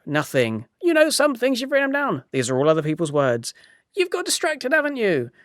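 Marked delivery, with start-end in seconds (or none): 0.8-2.2 high, squeaky put-on voice; mock scolding, pitched way up; nagging inner critic. Acts out his nagging inner voice. 3.9-5.3 high, squeaky put-on voice; mock scolding, pitched way up; nagging inner critic. Acts out his nagging inner voice.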